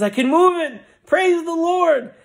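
high, loud cry of joy; exult, stretching out the praise; amazed rejoicing. Acted. Healed Mary cries out to the crowd, high and jubilant.

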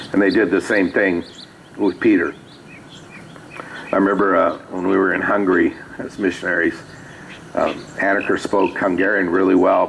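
normal talking voice

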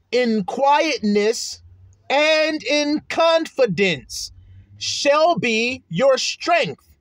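very slow, each word of "In quietness and in confidence" weighted; slow emphasis. Shown as a demonstration. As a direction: spell out "shall be your strength" slowly. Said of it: "I'm emphasizing it".

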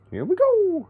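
sudden squeaky high voice; high-pitched. Pretend. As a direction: pitch it way up, playful. Repeats phrase, second much higher.

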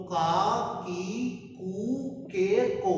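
का की कू के को spaced apart, each one said clearly; slow syllable drill. Shown as a demonstration. Teacher models the k-row syllables to students. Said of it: "next जो sound आएगा वो है का".

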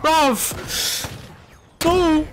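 high-pitched, exasperated call for help; alarmed plea. Real, not acted. Player to teammate who isn't helping, alarmed plea. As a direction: plead with a teammate, pitch jumping up.

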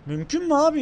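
incredulous. Real, not acted. Frustrated at impossible task.

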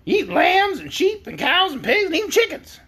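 high-pitched hen, rattling off a list; high, insistent talking hen; arguing hen. A narrator's reading. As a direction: pile up the accusations as a hen. Hen argues with the girl.

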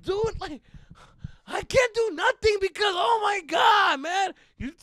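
very high, loud frantic shouting; mock outburst. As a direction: yell in teenage exasperation. Retold outburst at mom.

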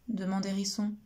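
ordinary delivery, nothing marked

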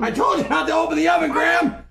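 fast, loud angry yell; angry shout. Real, not acted. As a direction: yell at him for opening it. Yelling at Graham over smoke.

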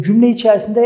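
usual speaking voice

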